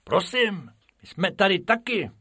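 high, polite little voice; timid rabbit asking to be noticed; high pleading rabbit. Acted. Bob reminding the keeper they are here too, high and polite. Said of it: "připomenul mu Bob".

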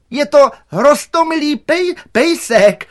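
very high, loud, faltering on "pejsek"; shrieked attempt to calm someone while terrified; strained reassurance. A narrator's reading. Director on pole calms the gardener, in panicky shouts. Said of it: "klidnil ho nedálku ředitel".

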